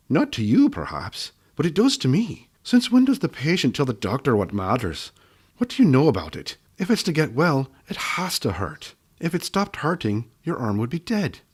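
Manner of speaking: a hint of a cross doctor lecturing; old gnome woman scolding a stubborn patient; scolding gnome healer.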